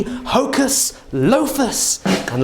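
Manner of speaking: high, slow magic incantation; kids party magician. Pretend. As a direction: intone it like a stage magician. Casting a spell on bread tin.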